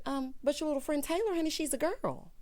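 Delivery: lightly voiced, puzzled grandmother; confused grandma. Grandma objecting that Taylor's a girl, mildly confused.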